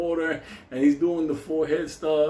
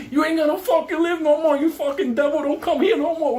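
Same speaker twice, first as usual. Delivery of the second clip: furious yell; 5 dB louder than usual. Retold or imitated. Yelling while beating devil.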